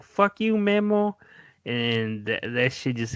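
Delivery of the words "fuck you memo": much higher, shrill-free mock yell of the curse; imitating her yell. Retelling the girlfriend cursing her man as he leaves, higher hammed-up yell. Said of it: "especially when she's like".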